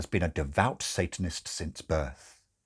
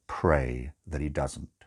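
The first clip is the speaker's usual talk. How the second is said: grim; 6 semitones lower, slower than usual. Acted. Crowley's grim reply.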